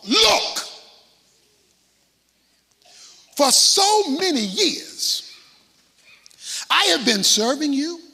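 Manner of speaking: loud, slow, high-pitched complaint; angry protest. Acted. Resentful older son shouting at his father, angry protest. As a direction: shout the grievance, drawn out.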